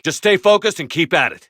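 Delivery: high, loud encouragement; shouting. Acted. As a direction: push them on loudly. Order shouted in battle.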